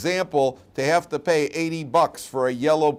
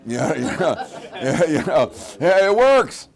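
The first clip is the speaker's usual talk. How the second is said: laughing; 5 semitones higher, 8 dB louder, slower than usual. Real, not acted. Laughing over pain relievers.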